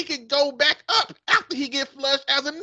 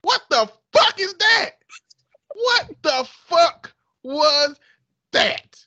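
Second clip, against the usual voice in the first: loud disbelief; 5 semitones higher, 6 dB louder, slower than usual. Real, not acted. Outraged reaction after the reading.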